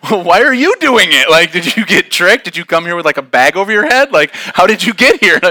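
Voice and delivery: high, loud comic bafflement; incredulous questioning. Retold or imitated. Hiker to the complaining girl, loud incredulous questioning. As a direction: fire the questions back, acting astonished.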